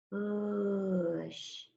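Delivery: "Push" softly stretched, vowel held into a long sh; very slow sounding-out. Shown as a demonstration. Teacher hunting for the next sound, very slow and soft. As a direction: draw "Push" out slowly and quietly, listening to it. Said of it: "I have to say it so, so slowly".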